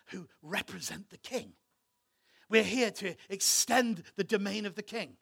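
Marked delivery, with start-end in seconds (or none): 2.5-5.2 ringing declaration. Acted. Preacher declares mission loudly.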